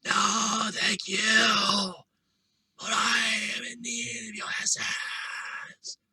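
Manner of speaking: slow raspy creature rasp. Acted. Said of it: "the raspy voice says".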